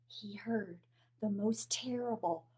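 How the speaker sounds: spooky whisper. A narrator's reading. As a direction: hush it like a secret. Scary build-up.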